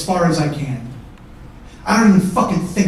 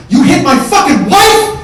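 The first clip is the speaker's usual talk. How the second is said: high, slow, furious roar; enraged yell; 8 semitones higher, 11 dB louder, slower than usual. Retold or imitated. Brother confronting Mike over his wife, roaring with rage. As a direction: explode in outrage, stretching the words.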